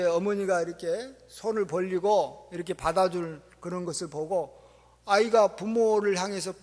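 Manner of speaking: ordinary talk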